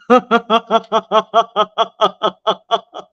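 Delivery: long, rapid chuckling laugh; helpless run of laughter; real laughter. Real, not acted. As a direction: crack up and keep chuckling. Cracks up at viewer's comment.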